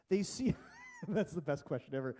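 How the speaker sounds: breaks into real laughter mid-sentence; laughing. Real, not acted. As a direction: laugh while answering the question. Laughing at a question from the congregation.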